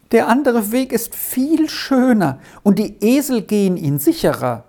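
put-on high-pitched boy, mildly pleading; stubborn young boy, voiced high; reluctant boy.

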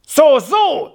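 loud, high jeering cry; indignant shouting. A narrator's reading. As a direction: cry out threateningly, pitch up. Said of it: "schrien nun alle drei durcheinander". (laut und drohend geschrien)

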